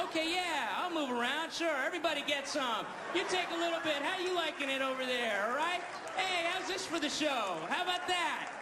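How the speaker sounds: high-pitched, gleeful revenge patter; cocky elephant playing showman; energised elephant taunting. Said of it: "now it's starting to get its energy".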